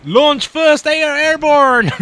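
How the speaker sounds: high, loud, slow command shout; mock command shout. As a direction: bellow the order slowly.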